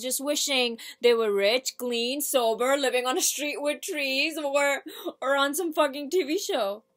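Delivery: building, insistent rant about their wasted wishing; monologue character, worked up. Acted. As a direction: play a worked-up speaker piling up their wishes.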